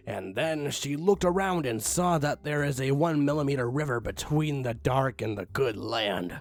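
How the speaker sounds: plain reading voice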